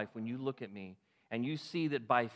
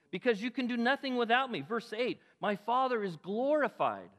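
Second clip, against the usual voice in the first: fervent preaching; 6 semitones higher than usual. Acted. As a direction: preach it out with full fire. Preacher reads scripture fervently.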